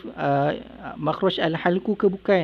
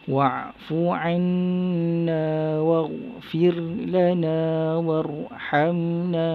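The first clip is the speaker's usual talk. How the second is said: measured recitation for students to follow; Quran recitation; 2 semitones higher than usual. Shown as a demonstration. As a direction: recite the verse in tajwid style. Teacher recites Quran verse.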